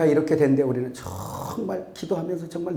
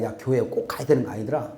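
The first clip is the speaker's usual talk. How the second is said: sly whisper; 7 semitones lower, 6 dB quieter than usual. Pretend. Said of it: "요렇게 사탄이 이제 속삭여 놨어요".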